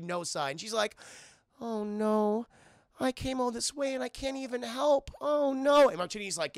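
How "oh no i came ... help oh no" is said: higher, plaintive acted wail; mock dejection. Olive turned away after coming all this way, acted dismay.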